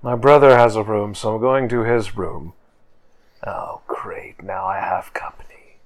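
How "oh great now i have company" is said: muttered aside. Real, not acted. Grumbling aside to himself.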